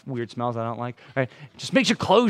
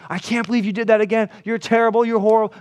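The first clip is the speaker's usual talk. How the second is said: higher, louder, condemning rant; harsh scolding; 8 semitones higher, 5 dB louder than usual. Pretend. Preacher acting a harsh friend berating a sinner, angry. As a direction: lay into them with disgust.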